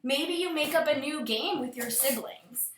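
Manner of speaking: ordinary delivery, nothing marked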